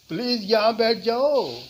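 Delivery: half-laughing loud invitation. Shown as a demonstration. Teacher demoing a loud laughing request to sit. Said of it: "इसके कहने के कई तरीके हैं".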